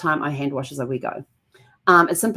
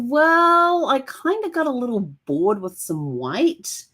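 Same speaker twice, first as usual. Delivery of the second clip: sheepish excuse; 7 semitones higher, slower than usual. Retold or imitated. Guilty excuse to husband.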